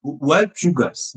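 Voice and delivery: ordinary delivery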